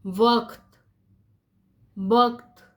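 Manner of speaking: वक्त with a throaty uvular q, वक़्त; nukta pronunciation. Shown as a demonstration. Teacher reading the word with the nukta, throaty वक्त. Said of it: "कैसे पढ़ रही हूँ उसको".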